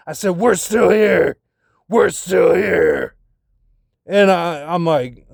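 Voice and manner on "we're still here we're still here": slow, strained, higher-pitched cry of frustration; exasperated outburst. Retold or imitated. Protesting to her that they have gone nowhere, exasperated. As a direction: drag each word out in mounting exasperation.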